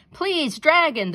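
high, loud pleading shout; shouting into the wind. A narrator's reading. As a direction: call out over the storm. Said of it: "the rich man shouted into the wind".

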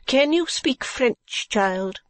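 high, slow questioning; Madame Mantalini. Acted. Madame interviewing a girl for work, high and slow.

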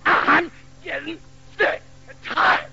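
a hint of a fed-up mother; scolding mother. Mother scolding her son while beating him, mock stern. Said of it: "And she went".

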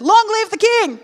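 very high, loud jubilant cry; triumphant shout. Acted. Daniel answering the king, retake, jubilant shout. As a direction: shout it out in victory. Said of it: "Let's try that again".

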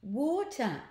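storybook eureka cry; sudden exclamation. A narrator's reading. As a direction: exclaim it as the idea strikes. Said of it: "exclaimed the boy".

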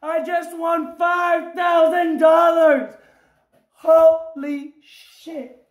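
real jackpot hollering with long stretched vowels; ecstatic shouting. Real, not acted. Player tells viewers of a biggest-ever win, ecstatic shouting. Reveals five thousand dollar win.

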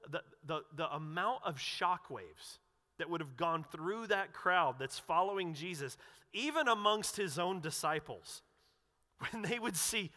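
normal talking voice

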